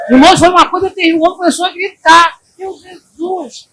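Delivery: loud, high, fast sermon cry; preaching at full force. Acted. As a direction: drive it home to the church. Pregação exaltada. (pregação exaltada e alta)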